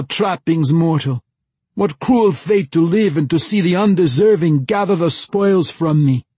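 high, loud melodramatic declamation; crying out verse. A narrator's reading. Young man wallowing in impromptu verse under the moon, crying out. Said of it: "he cried out".

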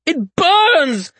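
high, loud pained holler. A narrator's reading. Malseus hollers as the mark burns his face, high and loud. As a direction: holler it out in sudden pain. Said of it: "he hollered".

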